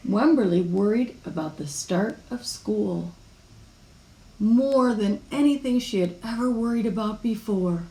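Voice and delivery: ordinary delivery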